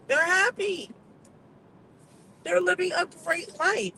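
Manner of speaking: high, bright emphasis; excited. Real, not acted. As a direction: raise the voice with delight. Emphatic, praising other countries.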